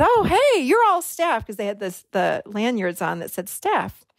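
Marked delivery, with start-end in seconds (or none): 0.0-1.5 high-pitched acted friendly discovery; bright greeting. Greeting staff women on the shuttle, sweetly surprised. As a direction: pitch it way up as a casual hello.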